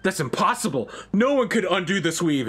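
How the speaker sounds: hammed-up high disbelief; mock shocked Egwene. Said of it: "she's like".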